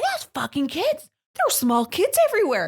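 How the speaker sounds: high, loud mock ranting mom; imitating Holly. Retelling neighbor Holly's rant after the fight, high-pitched mock rant. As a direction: squeak it out like a flustered mom. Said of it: "She was making me laugh".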